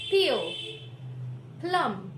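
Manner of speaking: peel and plum with popped P; emphatic model words; aspirated P. Shown as a demonstration. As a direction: push air through the P in peel, plum, falling. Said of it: "Take some examples".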